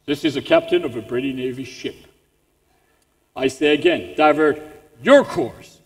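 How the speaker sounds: low, stern British naval captain; English accent. Acted. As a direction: pull rank in a British accent, slowing on the order. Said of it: "The British".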